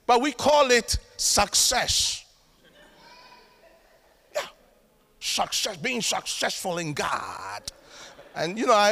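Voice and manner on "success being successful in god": slow, drawn-out put-on American preacher accent. Preacher imitating success preaching to church, in a mock American accent. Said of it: "I always like my American accent".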